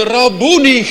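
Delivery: luid en hoog, op het hoogtepunt; jubelende uitroep. Retold or imitated. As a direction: roep het uit als de bevrijde. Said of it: "roept ze hem met blijde erkentenis aan". (luide, jubelende uitroep Rabboni)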